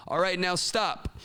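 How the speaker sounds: high, slow rhythmic cue; teacher's call-out. Shown as a demonstration. Teacher cueing kids to stop, high slow call-out. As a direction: call it out for a class to finish. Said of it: "Or the teacher can say".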